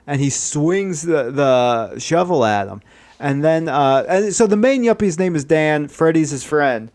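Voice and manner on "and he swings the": ordinary delivery, nothing marked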